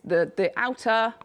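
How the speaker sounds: ordinary talk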